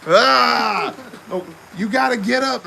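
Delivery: loud, high insisting; insistent nurse. Retold or imitated. Big nurse overruling the little one, loud insistence.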